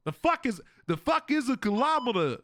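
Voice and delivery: high-pitched baffled American; caricatured American bewilderment; mock American accent. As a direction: put on a hammy American accent, incredulous. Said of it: "turning into your stupid fucking accent".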